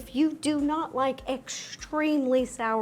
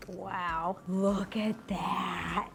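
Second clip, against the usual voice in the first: hushed awe; 6 semitones lower, 8 dB quieter than usual. Real, not acted. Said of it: "I'm having a moment".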